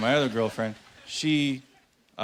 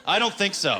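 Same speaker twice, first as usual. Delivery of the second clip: high-pitched, indignant dismissal; 7 semitones higher than usual. Acted. Comic rejecting a challenge to Big Bird, indignant. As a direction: cap the rant with an outraged refusal.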